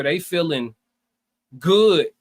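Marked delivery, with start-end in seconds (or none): none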